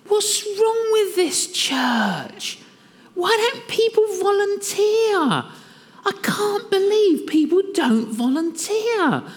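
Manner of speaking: high, mocking voice of his own indignant self; self-righteous grumbling. Preacher mocks his past self grumbling about church volunteers, in a high whiny voice.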